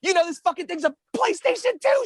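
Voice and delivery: high, squeaky imitation of his younger self; whiny kid voice. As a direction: whine at mom like a teenager. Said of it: "I'm like".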